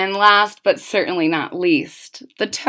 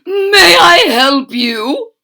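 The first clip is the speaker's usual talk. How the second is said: higher, louder and slow, a sing-song shopkeeper; shop lady; 7 semitones higher, 6 dB louder, slower than usual. Acted. The shop lady greets Mitzi: higher, slow, louder shopkeeper voice. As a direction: greet the young witch like an eager shopkeeper. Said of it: "asked the shop lady".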